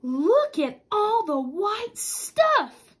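shout. Acted. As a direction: call it out in wonder. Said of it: "shouted Silbert Civet".

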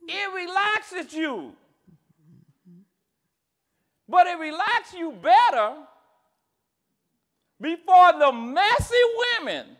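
drawn-out, punched sermon phrases; forceful pulpit delivery with long pauses; emphatic preaching. Real, not acted. Preaching, building to a joke.

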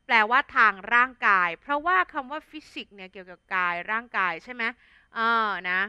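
usual speaking voice

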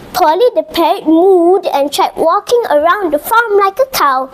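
usual speaking voice

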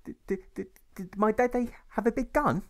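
put-on high, stuttering child's voice; a small boy pushing his question; stammering little boy. Boy asks mother about father.

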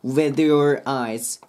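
slow "with your eyes" as one run; linked words. Shown as a demonstration. Teacher showing singers the link, slow and joined. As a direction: join "with" into "your". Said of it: "くっつけてあげると綺麗に歌えます".

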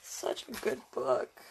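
breathy whispered praise. Real, not acted. Reader whispers praise for the book. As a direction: whisper it fondly.

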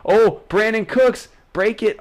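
high, loud urging; excited call. Real, not acted. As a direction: urge him to break free. Carrera de Cooks.

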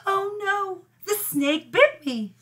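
mock dying cry. A narrator's reading. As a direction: overact the snakebite. Cleopatra bitten by snake.

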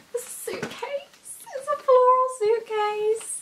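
very high, building to loud; high squeal of delight. Real, not acted. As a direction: squeal it out, overjoyed. Discovers floral suitcase.

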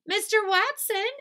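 high, loud call; Missus Watson shouting. A narrator's reading. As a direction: summon a husband urgently. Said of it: "she shouted".

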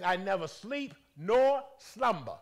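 loud, slow, booming declaration; proclaiming voice of God. Retold or imitated. Preacher voicing God's promise to the church, loud and slow. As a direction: let every word land like a decree. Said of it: "He says".

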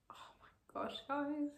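whispered, stunned delight; soft, amazed whisper; awed whisper. Real, not acted. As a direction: barely voice it in amazement. Tasting her fear food.

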